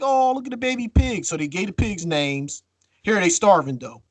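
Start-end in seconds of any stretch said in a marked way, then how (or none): 0.0-1.2 high-pitched imitation of girls fawning; mock cooing. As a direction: coo over a cute baby animal, mockingly. Girls fawning over baby pig.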